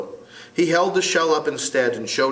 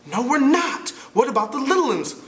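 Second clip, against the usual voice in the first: put-on higher, frustrated boy leader; 13 semitones higher than usual. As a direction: argue as an exasperated boy. Ralph voiced.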